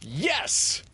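high, ringing yes. A narrator's reading. Peter answers the guessing game, ringing out proudly. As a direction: let the answer ring out high. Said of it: "the answer that rang out".

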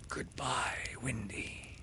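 soft, breathless farewell; whispered goodbye. Acted. Peter whispering goodbye to Windy as he pushes her.

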